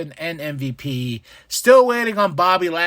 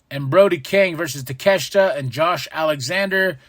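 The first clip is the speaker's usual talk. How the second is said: usual speaking voice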